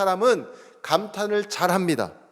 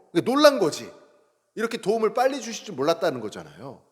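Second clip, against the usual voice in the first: ordinary talk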